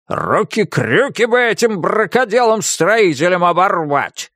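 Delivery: sudden cross outburst; emotional outburst. A narrator's reading. Kuzma cursing careless builders, sudden outburst. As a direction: burst out in irritation at the builders. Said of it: "Неожиданно эмоционально закончил он".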